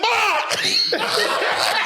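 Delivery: a high yelp of mock shock that breaks into a laugh; laughing yelp of surprise; mock startled cry. Shock at who came in.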